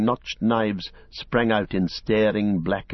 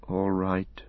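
half asleep; 5 dB quieter than usual. A narrator's reading. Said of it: "in a remote voice, as of one speaking half asleep".

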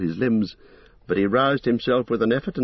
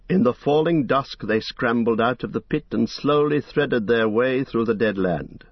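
plain reading voice

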